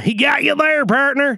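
loud, high cowboy hoot. Acted. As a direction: crow it like a cowboy. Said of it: "Say hello, Tom".

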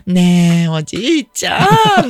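put-on sweet coaxing child voice; wheedling grandchild. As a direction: whine sweetly to grandpa for a treat.

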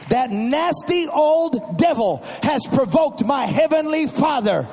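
loud, high climactic preaching; preaching at full force. Acted. Preacher rallying the congregation against the devil, loud. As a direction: whoop it up to the church.

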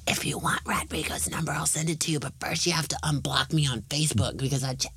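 hushed whisper. Acted. Whispered aside.

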